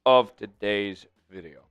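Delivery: brisk ad-read voice for Prize Picks; sponsor read. Acted. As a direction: sell it like a host reading an ad.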